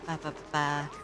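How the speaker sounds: normal talking voice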